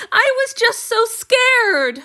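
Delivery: high, loud, slow tearful wail; crying. Acted. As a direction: weep out the fear. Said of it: "Han Yu cried".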